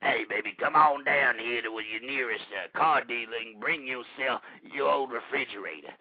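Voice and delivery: low, soft, put-on celebrity voice; Red Fox. Host has Red Fox pitch the junkyard, low impersonation. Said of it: "bring down Red Fox and have him say".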